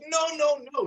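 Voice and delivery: comic denial; hammed-up refusal. Teacher acting the skit, high mock refusal. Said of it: "And he goes".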